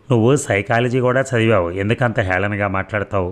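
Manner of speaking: ordinary delivery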